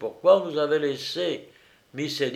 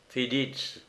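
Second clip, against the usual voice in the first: plain reading voice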